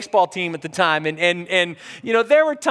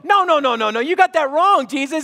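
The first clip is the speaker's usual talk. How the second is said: higher-pitched hurried objection; protesting; 4 semitones higher than usual. Acted. Believers answering Jesus's request, protesting. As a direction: push back in mock disbelief. People arguing back at Jesus.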